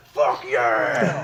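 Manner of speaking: loud, elated yell; re-enacted fan's cheer at full volume; excited cheer. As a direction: shout it out in triumph. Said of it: "I was like".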